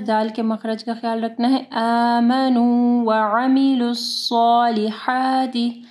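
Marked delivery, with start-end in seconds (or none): 1.7-5.8 slow tajweed recitation, heavy emphatic s in अमिलुस्सॉलिहाति; heavy saad. Shown as a demonstration. Teacher demonstrates the verse to students, slow measured recitation. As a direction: draw out the long vowels of आमनू व अमिलुस्सॉलिहाति. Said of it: "सौद को heavy पढ़ना है".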